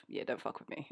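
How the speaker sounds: very soft, low mock threat; low-key warning. Showing friends her assassin face, quiet warning. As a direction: menace kept right down, almost under the breath.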